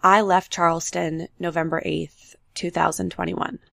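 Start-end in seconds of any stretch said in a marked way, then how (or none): none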